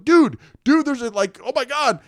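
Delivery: loud, high panicked yell, acted; retold shriek of alarm; frantic warning. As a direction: call out in mock alarm to warn a buddy. Retold frantic warning before crash.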